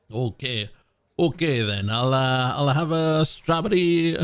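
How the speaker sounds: mock Italian accent. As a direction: put on a half-committed Italian accent. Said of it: "I'm not doing the Italian accent".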